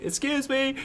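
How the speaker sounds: put-on squeaky little kid asking for attention; high kid voice. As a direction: pipe up like a small boy tugging a shirt.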